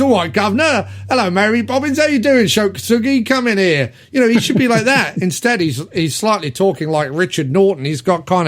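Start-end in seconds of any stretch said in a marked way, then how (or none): 0.0-3.9 higher, hammed-up Cockney accent; mock Cockney. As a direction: lay on a cheeky London Cockney. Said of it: "he should be talking like this".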